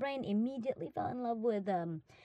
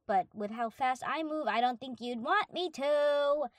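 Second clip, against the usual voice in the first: ordinary delivery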